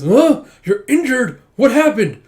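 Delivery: high, loud mock alarm; alarmed shock. Brother sees his sister is injured, shocked outburst. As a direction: blurt the questions, hammed-up and loud.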